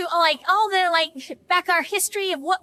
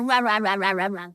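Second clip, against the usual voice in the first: low mock grumbling in nonsense syllables; comic wordless griping; upset grumbling; 8 semitones lower than usual. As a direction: grumble to yourself, pitched well down. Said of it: "So I am all upset".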